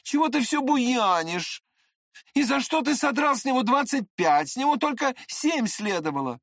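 high-pitched shouted reproach; Trishatov scolding. A narrator's reading. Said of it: "крикнул ему Тришатов".

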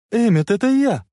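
high, loud, happy call; joyful shout. A narrator's reading. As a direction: cry out in delight. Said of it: "радостно закричала она". (радостный крик)

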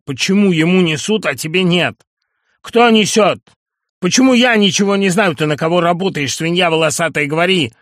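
high, loud shouted demands; furious yelling interrogation. Acted. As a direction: bellow at him to talk. Nunan interrogating Masul furiously.

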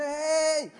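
mock yell. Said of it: "I don't want to show you how it's done".

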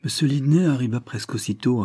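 plain reading voice

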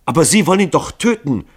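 high, loud, insistent accusation; indignant outcry; accusing. A narrator's reading. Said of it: "wiederholte sie ihre Anschuldigungen".

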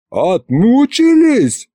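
high, drawn-out incredulous cry; outraged yell. A narrator's reading. Husband repeating sorcerer's word in disbelief, yelling. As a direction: echo it slowly in high-pitched outrage. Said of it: "заорал и я".

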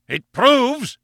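sudden high, loud outburst; furious roar. A narrator's reading. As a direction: explode after a coaxing build-up. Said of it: "he roared".

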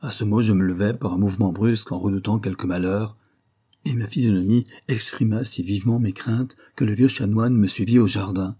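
ordinary delivery, nothing marked

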